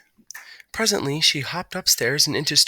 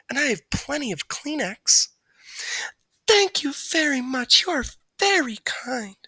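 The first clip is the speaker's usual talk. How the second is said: high character dialogue. A narrator's reading. Margalo and Stuart dialogue.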